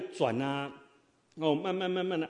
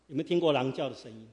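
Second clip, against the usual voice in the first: ordinary talk, nothing marked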